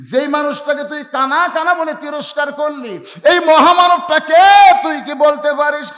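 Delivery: loud, high, impassioned challenge; stern rebuke. Acted. Companion rebuking Rakhal for insulting a great man, loud and stern. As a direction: demand an answer at the top of your voice.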